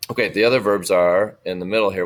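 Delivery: ordinary talk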